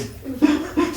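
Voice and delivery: a bright, high burst of giggling; high giggle. Real, not acted. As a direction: giggle with delight. S1 excited about free food.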